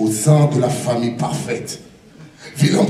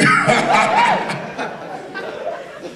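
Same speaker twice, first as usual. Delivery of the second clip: high laugh. Real, not acted. Laughter burst.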